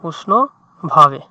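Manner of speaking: word drawn out slowly while writing; slow dictation. Shown as a demonstration. Tutor writing the word for a student, slow. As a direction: spell it out syllable by syllable. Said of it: "এখানে যদি আমি লিখি".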